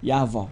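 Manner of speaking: Yavah repeated low, with open ah vowels; right pronunciation. Shown as a demonstration. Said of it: "That's the right pronunciation".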